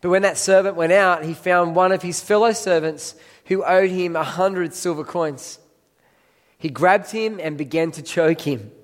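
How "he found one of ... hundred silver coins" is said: ordinary talk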